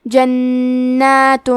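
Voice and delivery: جنات with the ن held double and nasal; doubled noon. Shown as a demonstration. Teacher shows the doubled ن, very slow جنات. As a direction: hold the ن in جنات twice as long. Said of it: "دو حرف کے برابر نون کو پڑھیں گے".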